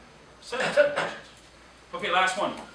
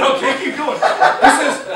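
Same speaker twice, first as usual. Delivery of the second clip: laughing retold lines; 4 semitones higher, 11 dB louder, slower than usual. Real, not acted. Retelling while laughing.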